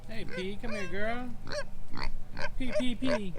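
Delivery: high, coaxing calls to the animals; pet talk. Real, not acted. Calling the big girls and P over, high coaxing pet voice.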